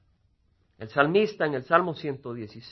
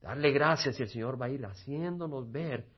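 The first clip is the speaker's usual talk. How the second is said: soft reflective close; 6 semitones lower, 11 dB quieter than usual. Acted. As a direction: gently urge the congregation inward. Cierre suave y reflexivo del sermón. (exhortación suave y baja)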